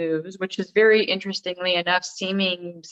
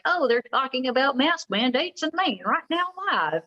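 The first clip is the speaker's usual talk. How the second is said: put-on old country accent; 6 semitones higher than usual. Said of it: "Very intentionally doing my old country accent".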